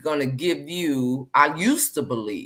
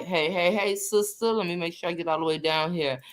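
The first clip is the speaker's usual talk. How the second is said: ordinary delivery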